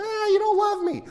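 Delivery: put-on very high sulky kid voice; whining child. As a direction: pout like a denied child. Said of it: "child whining about candy".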